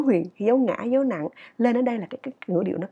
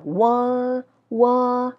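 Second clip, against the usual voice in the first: "wo" long, held high and level; higher stressed syllable; 3 semitones higher than usual. Shown as a demonstration. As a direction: sustain "wo" above the other syllables. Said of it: "cao tông hơn". (đọc "wo" kéo dài, cao tông)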